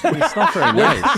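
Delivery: giggling it out as a half protest; laughing. Real, not acted. Guest laughing about the host's mean tweets.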